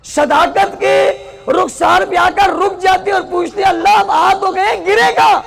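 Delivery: high, loud preacher's cry, rising to a peak; impassioned preaching. Acted. Preacher crying out to mothers and sisters, impassioned preaching. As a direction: preach it out to the crowd, voice soaring.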